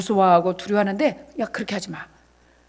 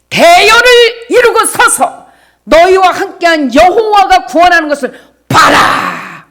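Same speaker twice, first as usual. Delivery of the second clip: full-force preaching; 7 semitones higher, 10 dB louder than usual. Acted. Pastor reading God's promise to the congregation, loud preaching. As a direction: proclaim the verse like a rallying cry.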